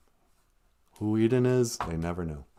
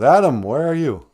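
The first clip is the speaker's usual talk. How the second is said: loud, raised searching call; acted calling out; 6 semitones higher, 9 dB louder than usual. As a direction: call for someone out of sight. God calls out to Adam.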